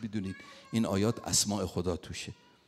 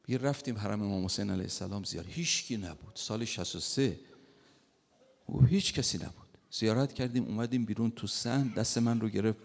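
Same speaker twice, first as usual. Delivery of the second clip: ordinary talk, nothing marked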